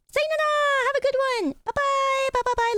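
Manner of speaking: high, cheery farewell; sing-song goodbye. Real, not acted. Sign-off to chat.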